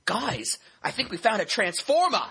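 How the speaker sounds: put-on thick Boston accent, high and excited; Mark Wahlberg. Co-host quoting the movie scene, thick Boston accent. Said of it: "he has a thick Boston accent".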